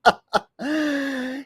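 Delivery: a big real laugh; real burst of laughter. Real, not acted. Host laughing at a clip's silly voice, real laughter.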